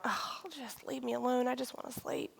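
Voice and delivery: hushed, sighing irritation; eye-rolling exasperation. Retold or imitated. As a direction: groan it softly, wanting to be left alone. Rolling my eyes going.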